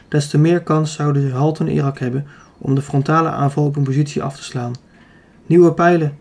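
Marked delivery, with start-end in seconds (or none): 5.5-6.2 louder, lightly called order; mild commander's call. A narrator's reading. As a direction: call briefly for supplies, restrained. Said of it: "riep hij".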